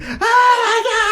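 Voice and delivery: put-on children screaming as the dog is hit; horrified kids screaming. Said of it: "a crowd of thirty children going".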